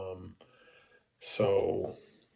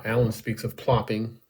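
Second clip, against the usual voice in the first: usual speaking voice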